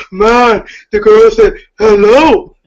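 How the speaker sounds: loud, amused ribbing of someone's voice; mocking young peer. Young guy to his peer at the festival, loud mocking imitation. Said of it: "so when he said that".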